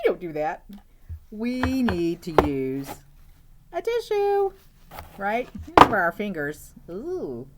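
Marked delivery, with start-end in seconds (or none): 1.3-6.7 scolding. Real, not acted. Teacherly scolding, loud.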